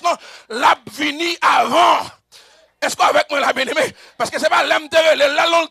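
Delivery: driving, insistent preacher's shout; full-force preaching. Acted. Preacher telling believers God already knew, full-force preaching.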